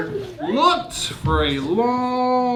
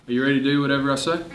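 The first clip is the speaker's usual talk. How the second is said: fast, lower kingly demand; playing the king; 9 semitones lower, much faster than usual. Teacher as pretend king quizzing kids, quick and low. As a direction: boss the class like a pretend king. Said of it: "I'm gonna be the king".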